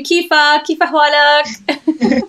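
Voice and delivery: slow Arabic greeting in Lebanese accent. Shown as a demonstration. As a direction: say Kifa Hwalak with a Lebanese accent, drawn out. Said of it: "in the Lebanese accent".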